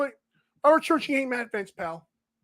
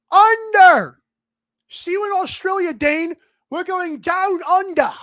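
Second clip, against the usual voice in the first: hammed-up Australian accent, the first Under punched loud and high; bad Australian accent; 4 semitones higher, 5 dB louder than usual. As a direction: play a clumsy Aussie, drawing out down under. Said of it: "A bad accent".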